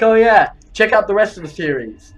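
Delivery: mock announcer. Mocking their own single-episode series, mock promo. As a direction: hype it up like a fake outro. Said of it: "It's like".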